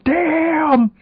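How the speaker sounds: high, drawn-out mock movie yell; theatrical high-pitched cry; yelled Damn. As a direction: yell it high and long, hammed-up. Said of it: "and then yell".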